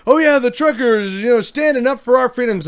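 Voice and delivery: high, sneering parody of fans; mock their cheerful slogan. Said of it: "people that I love seeing all around about".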